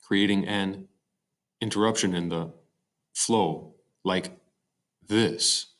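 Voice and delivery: halting read, "this" left hanging alone after a pause; broken line breaks. Shown as a demonstration. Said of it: "seems like a bunch of interruptions".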